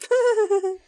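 high-pitched squealing laugh. Real, not acted. Friend giggling after a false scare, high squeal.

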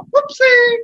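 very high, loud squeal; squeaky Toasty voice. Retold or imitated. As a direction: shriek it cartoonishly. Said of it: "get yourself a".

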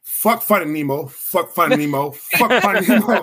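loud, punchy, building to a laugh; emphatic declaration. Real, not acted. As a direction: slam the title each time. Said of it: "I'm gonna say something that's very controversial".

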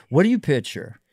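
normal talking voice

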